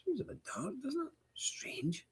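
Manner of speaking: hushed unvoiced whisper, half to himself. Real, not acted. Host whispering to viewers about the dark picture, a hushed whisper. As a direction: whisper it like you're peering into the gloom. Host peering at dim camera.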